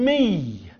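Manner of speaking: "Me" held long with a falling tune; one long, falling "Me"; emphatic ME. Shown as a demonstration. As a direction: stretch out "Me" as a self-important statement. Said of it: "that almost would seem arrogant".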